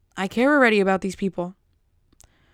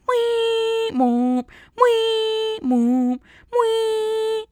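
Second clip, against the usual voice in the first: [name] sounds; 13 semitones higher, 4 dB louder, much slower than usual. Pretend. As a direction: squeak the wee high, drop to moop. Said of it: "from [name]".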